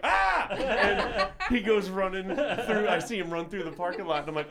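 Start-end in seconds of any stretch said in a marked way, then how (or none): 0.1-0.5 high, loud startled shriek; sharp high yelp of surprise. Retold or imitated. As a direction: yelp like you just got jumped. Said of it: "we both are like".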